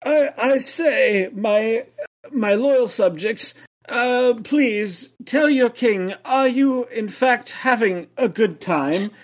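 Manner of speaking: high, slow royal proclamation; a pompous king addressing his subjects; warm regal boom. Acted. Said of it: "the king steps forward and says".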